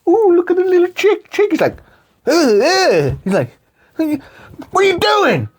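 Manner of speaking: high, put-on voice; mock cooing then disgust. Friend imitating Ben Stiller with chick, put-on voice. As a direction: coo at the chick, then recoil.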